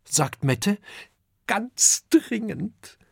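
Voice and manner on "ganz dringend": put-on high, curious child voice; little dragon Kokosnuss asking his father, high and curious. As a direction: ask it as an eager small boy. Child begs urgently.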